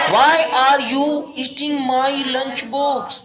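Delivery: very slow dictation. Shown as a demonstration. Teacher dictating the English question to the class, very slow. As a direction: stretch every word, stress on why. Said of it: "तुम मेरा lunch box क्यों खा रहे हो".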